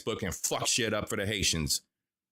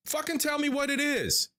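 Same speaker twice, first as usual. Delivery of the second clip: furious outburst; 13 semitones higher than usual. Real, not acted. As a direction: pitch jumps far higher into an exasperated yell. Ranting at politicians' vague plans.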